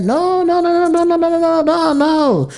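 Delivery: a string of high, pleading no's; mocking denial. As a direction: mimic someone refusing to listen. Mock denial, repeated no's.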